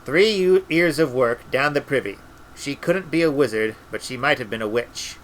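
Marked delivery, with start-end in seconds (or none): none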